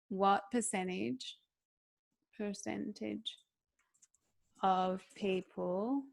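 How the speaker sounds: slow, soft and drawn out. Shown as a demonstration. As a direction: breathe the words slowly. Demonstrating slow ASMR delivery.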